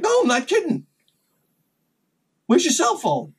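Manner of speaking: high, exasperated comeback; insistent retort. Retold or imitated. Worker answers laughing guy about cell phone, high insistent retort.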